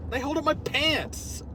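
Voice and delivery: high, slow, stating the obvious; mock exasperation. Vlogger explains his red suspenders, exasperated. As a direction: stretch it out as if absurdly obvious.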